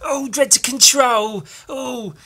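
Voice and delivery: Frank Spencer impression. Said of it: "talk like Frank Spencer".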